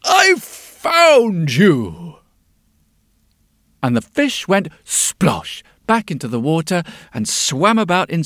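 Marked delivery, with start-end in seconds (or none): 0.0-2.2 slow, loud cry of triumph; triumphant yell. Acted. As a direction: call it out slow, he has finally found her. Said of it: "he yelled out in triumph".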